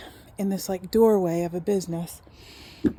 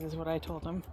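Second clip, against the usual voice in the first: usual speaking voice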